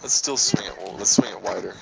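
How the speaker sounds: whispered gaming aside. Real, not acted. To the co-player mid-game, a whisper. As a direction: murmur it under the breath.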